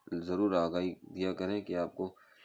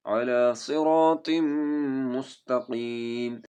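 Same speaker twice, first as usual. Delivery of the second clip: melodic chanted tajweed recitation; Quran recitation; 4 semitones higher, 6 dB louder, much slower than usual. Shown as a demonstration. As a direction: recite slowly and musically, stretching vowels. Teacher recites Quran verse.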